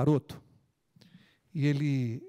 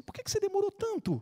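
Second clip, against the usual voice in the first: put-on scolding old wife; faster than usual.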